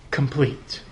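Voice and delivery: Complete, low and soft, set off by pauses; quiet weighted word. Acted. Preacher revealing key word, low soft emphasis. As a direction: drop the voice and land Complete. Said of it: "I hope that's arresting to you".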